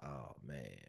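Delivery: low, very soft groan of defeat; caught dread. Retold or imitated. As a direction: sink under the words, barely audible.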